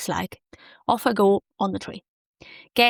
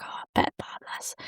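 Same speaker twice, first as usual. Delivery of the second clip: breathy self-satisfied whisper; secretly delighted whisper about her own outfit; 8 dB quieter than usual. Retold or imitated. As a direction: whisper it to yourself, pleased. Recalling how she felt in outfit.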